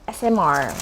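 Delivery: ordinary talk, nothing marked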